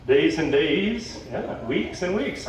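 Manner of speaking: slow, lingering suggestive delivery; slow mock-seductive exchange; slow teasing. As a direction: stretch it out suggestively. Mermaid getting close, flirty exchange.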